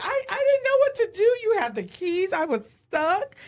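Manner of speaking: hammed-up sobbing excuse; mock crying. Wife retelling her tearful excuse to her husband, crying.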